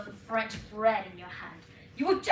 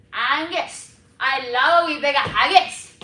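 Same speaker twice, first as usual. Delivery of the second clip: loud, emphatic Scots; hammed-up Scottish with jutting chin; Scottish dialect; 9 dB louder than usual. Shown as a demonstration. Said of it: "For Scottish".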